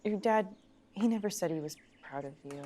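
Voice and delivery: soft, sinking low; gentle confession. Acted. Friend coming clean about his dad, quiet reluctant confession.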